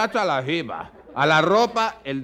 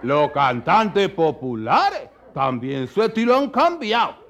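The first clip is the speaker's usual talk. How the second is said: usual speaking voice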